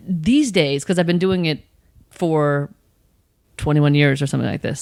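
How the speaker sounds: normal talking voice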